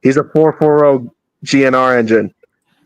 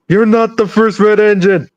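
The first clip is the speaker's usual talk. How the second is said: fed-up Thomas; 7 semitones higher than usual. Acted. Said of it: "Thomas being fed up saying".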